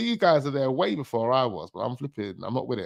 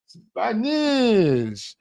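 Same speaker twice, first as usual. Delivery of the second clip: a higher, drawn-out, hammed-up Spanish accent on "Spanish"; mock Spanish accent; 9 semitones higher than usual. Vlogger jokingly tries a Spanish accent on the word, mock Spanish accent. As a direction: say "Spanish" with a put-on Spanish accent, pitched up. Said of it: "Spanish accent".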